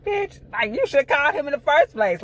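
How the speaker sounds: high, loud outraged yell; furious high-pitched rant. Retold or imitated. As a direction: scream at her through the screen. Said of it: "I'm like".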